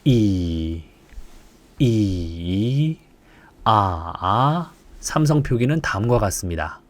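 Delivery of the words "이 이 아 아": "이" and "아" dipping to the bottom and coming back up; third tone dip. Shown as a demonstration. Teacher shows the third tone dip on "이" "아". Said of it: "바닥을 찍고 올라와도 됩니다".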